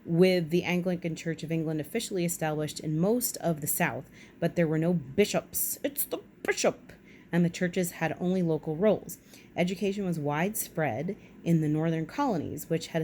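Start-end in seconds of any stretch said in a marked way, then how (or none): 5.8-6.9 high put-on cry of surprise; mock exclamation. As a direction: announce the bishop like a cartoon. Sudden joking exclamation.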